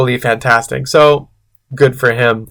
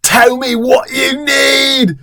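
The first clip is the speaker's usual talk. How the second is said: mock yell climbing higher; hammed-up demanding yell; Tom; 6 semitones higher than usual. A narrator's reading.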